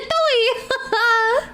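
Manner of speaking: Toei! laughed out with a rising pitch; Toei, burst out mid-laugh. Real, not acted. Repeating the odd word, laughing.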